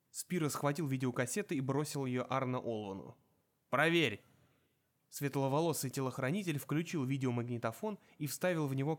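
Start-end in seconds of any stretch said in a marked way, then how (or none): 3.7-4.2 barked order. Acted. Spiro orders his bodyguard.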